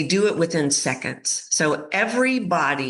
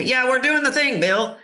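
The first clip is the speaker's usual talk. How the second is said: dismissive board; 9 semitones higher than usual. Retold or imitated. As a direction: wave him away cheerfully. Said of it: "they're going".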